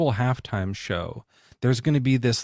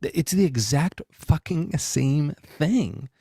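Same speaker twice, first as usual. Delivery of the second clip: higher, slow, knowing mock aha; sarcastic realization; 6 semitones higher than usual. Shopper at the store recognizing the trick, sarcastic drawl. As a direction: drag it out like you finally see the scam. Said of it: "you're like".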